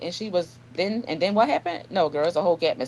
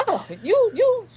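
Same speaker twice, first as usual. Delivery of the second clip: high-pitched, caught-off-guard stammer; a squeaky, flustered "oh... okay, all right"; flustered squeak; 4 dB louder, slower than usual. Retold or imitated. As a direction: retell your own startled reply way up high. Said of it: "I said".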